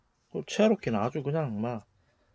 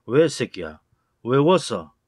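louder, hostile challenge; angry yell; 7 dB louder, slower than usual. Acted. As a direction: snap at someone for smiling. Angry man confronting someone smiling. (시비 거는 화난 고함)

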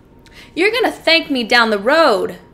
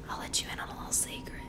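very soft whispered confidence; hushed, teasing aside; conspiratorial whisper; 18 dB quieter, faster than usual. Acted. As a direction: whisper it like a shared secret. Confides a secret to lawyer.

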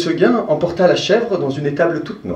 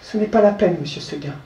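higher, then fading soft; polite little goat; 3 semitones higher, 7 dB quieter than usual. Acted. The goat turning down Seguin's offer, soft and polite. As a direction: gently decline.

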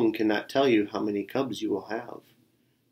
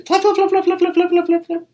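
very high, loud rapid-fire flaps; furious flapping; 9 dB louder, faster than usual. A narrator's reading. Bird flaps her wings at Tiger, furiously. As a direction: imitate frantic wingbeats, high and loud. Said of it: "flap her wings furiously".

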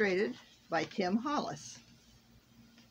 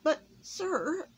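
pleading elf; 10 semitones higher than usual. A narrator's reading. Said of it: "pleaded the miserable elf".

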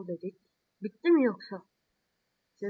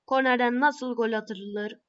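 mock tutorial intro; 5 dB louder than usual.

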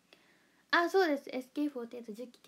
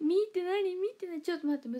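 loud, higher-pitched fluster; flustered protest; 9 semitones higher, 7 dB louder than usual. Real, not acted. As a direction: protest in a real fluster, voice jumping up. Flustered by a difficult comment.

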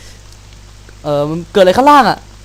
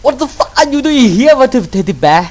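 high, loud, drawn-out scream of shock; real panicked scream; terrified shout; 8 semitones higher, 5 dB louder, much slower than usual. Real, not acted. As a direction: yell in real fright at the monster. Jump scare in horror game.

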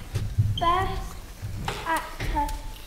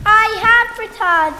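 loud; 4 semitones higher, 11 dB louder than usual. Acted. King returns, loud entrance.

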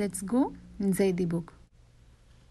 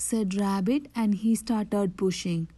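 ordinary delivery

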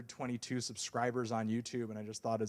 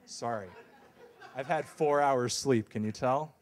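normal talking voice

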